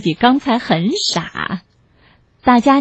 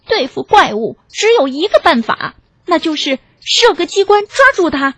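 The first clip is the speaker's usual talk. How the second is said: lightly voiced owl, raised pitch; authoritative owl; 6 semitones higher than usual. A narrator's reading. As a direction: announce the one clever plan.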